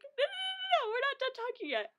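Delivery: high, mock teasing insistence; teasing a friend back to the topic; insistent no. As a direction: pile on the noes playfully. Herself insisting.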